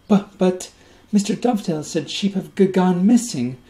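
put-on high, loud, stammering little boy; a scared five-year-old gulping his words; hiccuping child voice. A narrator's reading. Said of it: "hiccuped Bert".

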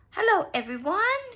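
high, loud cheery greeting; cried out. A narrator's reading. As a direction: call out to the whole group. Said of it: "cried Rebecca".

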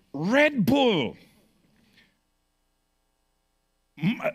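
high, loud, driving preacher voice; fiery preaching. Acted. Pastor warning the church about energy drinks, fiery preaching. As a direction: preach it hard to the congregation.

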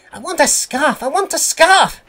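very high, loud chant; puffing engine. A narrator's reading. Percy demanding a scarf, high insistent puffing. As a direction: pipe it up like an insistent little engine. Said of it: "he would puff".

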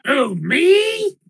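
high, loud whiny oh me; the Minotaur squeals in surprise at being named; mock innocent surprise. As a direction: play wounded innocence. Player feigns innocent surprise.

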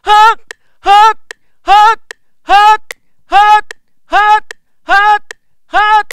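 very loud, high mixed-voice "ha"; strong, ringing high notes on one pitch. Shown as a demonstration. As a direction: belt short offbeat pulses in mix. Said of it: "今度はミックスボイスでやってみましょう".

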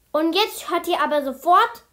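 loud, high-pitched teacher's order; angry scolding. A narrator's reading. Teacher telling the class to stop, loud scolding. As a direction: order them sharply, at full volume.

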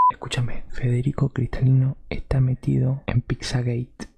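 a mock conspiracy theorist sharing a scoop; conspiratorial secret-telling voice. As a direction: lean in and leak a big secret. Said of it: "Para que no nos espíen".

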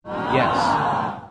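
a loud, breathy burst of yes; hammed-up whooping yes; excited shout. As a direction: blurt it out, thrilled to agree. Cohost eagerly agrees with Harry.

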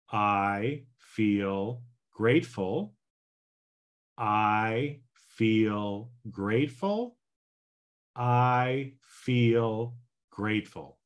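slow, deliberate I feel grateful; slow affirmation. Shown as a demonstration. Coach demonstrating the ten-by-three affirmation slowly. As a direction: say it slowly, calmly, with conviction. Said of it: "so here we go again".

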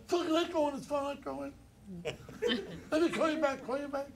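high, teasing impression of Joe on the phone; imitation of Joe Regano. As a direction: pitch way up to mimic Joe. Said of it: "He goes".

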